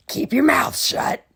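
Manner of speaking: loud harsh witch voice; Miss Swamp stern. Acted. Miss Swamp cancelling story hour, loud harsh witch voice. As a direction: deny them coldly. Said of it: "said Miss Swamp".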